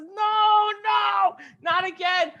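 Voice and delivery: very high, loud panicked shout; shouting no again. Acted. Store owner shouts at the camel coming for the pot. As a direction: yell to stop the camel, alarmed.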